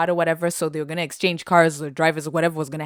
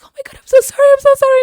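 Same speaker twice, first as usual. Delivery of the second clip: much higher, louder frantic sorry; imitating mom's apology; 12 dB louder, much faster than usual. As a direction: gush the apology in a panic. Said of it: "she was like".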